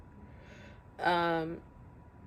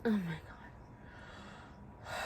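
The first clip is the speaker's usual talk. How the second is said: slow, hushed, fighting back tears; real choked-up near-whisper; close to tears; 10 dB quieter, much slower than usual. Real, not acted. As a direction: barely get it out, about to cry. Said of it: "I feel like crying right now".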